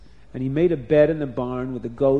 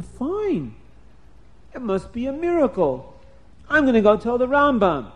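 higher and louder, bursting with joy; joyful excitement; 7 semitones higher, 4 dB louder than usual. Acted. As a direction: rush it like good news. Avram realizes miracle.

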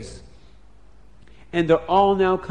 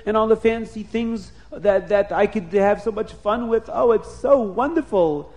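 high, excited gushing; overjoyed king; 6 semitones higher, 4 dB louder than usual. A narrator's reading. King jumps up and down over presents, overjoyed. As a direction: gush through the list like a delighted child. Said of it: "the king was jumping up and down".

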